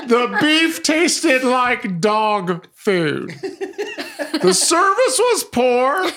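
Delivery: high, slow, hammed-up complainer voice; funny voice. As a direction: drag out every gripe in a high funny voice, laughing through it. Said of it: "read some final bad reviews and funny voices".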